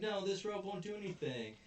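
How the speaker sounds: high-pitched alarmed objection; high, hammed-up no; mock protest. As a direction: act out kids objecting. Acted protest at the bridge.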